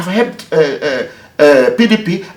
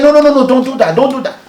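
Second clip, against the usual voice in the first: high, louder hurried no's in alarm; alarmed protest; 11 semitones higher, 5 dB louder, faster than usual. Retold or imitated. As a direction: stop him before he makes that call. Governor alarmed at Buhari threat.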